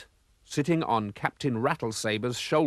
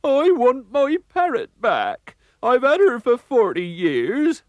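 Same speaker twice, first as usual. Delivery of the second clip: high, loud, slow sobbing plea; blubbering pirate; 12 semitones higher, 7 dB louder, slower than usual. A narrator's reading. Villain pirate cries for his parrot, high loud blubbering. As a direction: bawl for the lost parrot. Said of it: "burst into tears".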